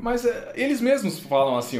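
ordinary delivery

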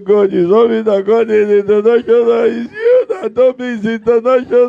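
high, wailing mock sob at God; mock crying complaint. Preacher complaining to God with a wailing mock cry. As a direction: whine to God like a wronged child.

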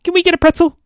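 very high, loud pleading voice; eager little sister. Acted. Gabby asking her sister for a pretzel, high eager plea.

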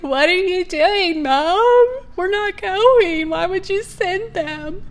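high, slow, pleading wail, as if through tears; reliving the panicked sob at the airport; crying, retold. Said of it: "I'm crying".

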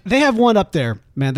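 ordinary talk

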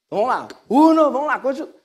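loud, high game chatter; rally the players to keep going; empolgado no jogo. Acted. Jesus carries on the Uno game. (animado, chamando pro jogo)

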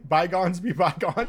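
"Bygones" as slow, high "by-GONZ"; odd pronunciation of bygones. Shown as a demonstration. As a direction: repeat "bygones" slowly and loudly with a questioning "gonz". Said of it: "Why are you saying it like that?".

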